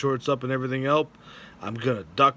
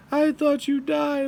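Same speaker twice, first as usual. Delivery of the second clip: high mock wife; 13 semitones higher than usual. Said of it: "She's like".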